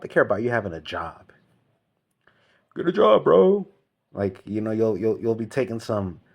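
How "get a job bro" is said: high, loud whiny mock of people; high mocking nag; mocking nagging voice. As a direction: sneer it like a nagging busybody.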